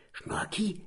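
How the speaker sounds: breathy excited whisper; boy whispering his sudden plan. Acted. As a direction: whisper the secret idea. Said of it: "huscht's aus Borkis Mund".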